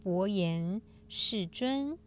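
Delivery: slow, measured scripture reading; sutra recitation. Acted. Host reciting today's sutra passage to listeners, solemn recitation.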